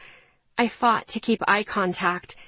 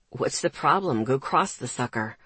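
put-on lower, careless teenage brother; brother's voice; 8 semitones lower than usual. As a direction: toss it off as the brother.

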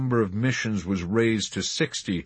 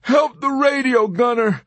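loud, high, desperate plea; begging; 8 dB louder than usual. A narrator's reading. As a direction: cry out, pleading for his crewmate. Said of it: "Dobbs begged".

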